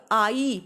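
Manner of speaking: आई glided from aa into ee; emphatic diphthong demo of आई; two vowels joined. Shown as a demonstration. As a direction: stretch आई so both vowels are heard. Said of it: "आ और ई आपस में मिले".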